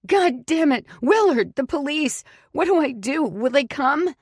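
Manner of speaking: very high, loud hysterical yelling; cursing the husband and calling to Willard, hysterical; frantic shouting. A narrator's reading. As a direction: scream it out, losing control. Frantic outburst at captive.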